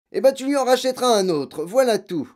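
very high, loud curt retort; harsh dismissal. Acted. Father dismissing his daughter's protest, loud and curt. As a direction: brush her off harshly.

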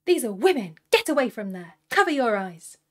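loud, commanding yell; outraged, forceful demands; scolding shout. A narrator's reading. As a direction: order them away at full volume. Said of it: "The men around him scolded him and demanded".

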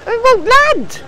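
high, loud complaints; frustrated yelling. Real, not acted. Player failing to land, shouts in frustration. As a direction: protest at the ship loudly.

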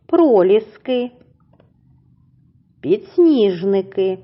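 each flower name said slowly and clearly for repeating; slow model words. Shown as a demonstration. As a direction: model the words slowly so children can copy. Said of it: "повторіть за мною їх назву".

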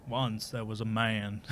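a lightly voiced bedtime narrator, drawn out; shitty Morgan Freeman. Shown as a demonstration. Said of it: "that was terrible".

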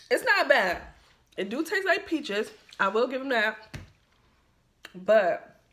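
chewy candy in mouth; talking while chewing; mouth full, chewing. Real, not acted. Seen 2: Hand to mouth before; jaw working, lips pursed while talking.

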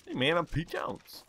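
hammed-up character hello. Shown as a demonstration. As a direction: pitch it way up for the demo.

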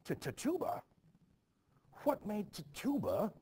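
sharp high alarmed questions; shocked disbelief. Acted. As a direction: recoil at the name, pitch jumping up. Minister shocked at Tituba mention.

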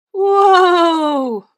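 long, high, booming holler; very loud drawn-out Santa cry; startled cry. A narrator's reading. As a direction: yell out as if yanked backwards. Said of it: "cried Santa".

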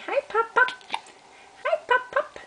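put-on squeaky high voice for the baby.

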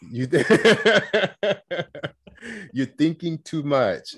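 imitated teacher's accent, high and slow, breaking into a laugh; Miss Saeed's accent. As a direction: mimic her accent and crack up laughing mid-line. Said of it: "I always laugh and I do my accent".

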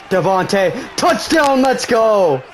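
high, loud yell of triumph; excited touchdown shout. Real, not acted. Gamer yelling at his touchdown, high excited shout. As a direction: shout it like you just scored.

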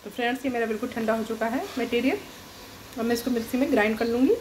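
normal talking voice

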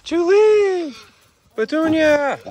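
high, loud call across a field. Real, not acted. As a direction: shout the names to call them over. Calling animals across the yard.

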